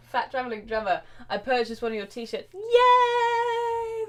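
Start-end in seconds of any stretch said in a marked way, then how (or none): 2.5-4.0 high, loud cheer; a real happy whoop. Real, not acted. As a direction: cheer for the fan. Thanking a merch buyer.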